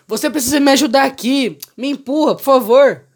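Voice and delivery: voz aguda e rápida de bêbado. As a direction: implorando ajuda como um bêbado. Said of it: "Aí o bêbado falou". (bêbado suplicando na janela)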